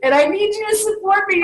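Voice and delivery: half-joking whine at her husband; wife whining at husband for support, mock pleading; whiny demand. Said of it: "and I'm like".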